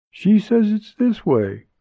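high-pitched voice for a woman character; lifted, high female-character voice; woman character's voice. A narrator's reading. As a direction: pitch her well above the narration. Woman character calls back to the men.

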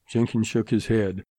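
ordinary delivery

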